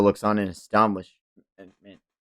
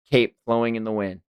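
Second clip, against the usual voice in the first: ordinary talk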